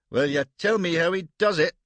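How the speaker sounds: loud, high gruff Scots demand; a grudging admirer grumbling loudly; loud Scottish-accented grumble; growled. Acted. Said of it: "growled Paitingi".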